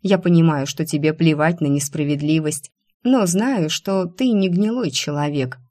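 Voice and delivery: ordinary delivery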